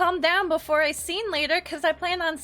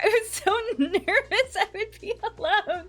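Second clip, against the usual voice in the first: nervous laughter; 5 semitones higher, 3 dB louder than usual. Real, not acted. Relieved, laughing about being alone.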